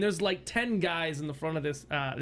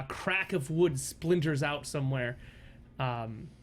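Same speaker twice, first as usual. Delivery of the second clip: normal talking voice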